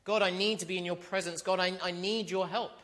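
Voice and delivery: put-on a bullied boy crying out to his father; urgent plea. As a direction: beg God for help, scared. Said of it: "runs straight to God and says".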